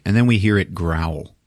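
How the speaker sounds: normal talking voice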